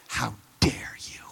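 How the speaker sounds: whispered, slow mock outrage; a half-whispered indignant father; hushed indignation. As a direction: breathe it like a wounded protest.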